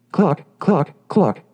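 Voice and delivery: high clucking like a hen. A narrator's reading. The hen announcing herself to Tuck, high clucking. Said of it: "cried a hen".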